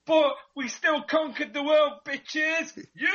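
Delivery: a British guest mock-boasting to the American host, hammy and gloating; mock imperial gloat. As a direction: crow over the empire like a pantomime villain. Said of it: "just going a bit imperial there".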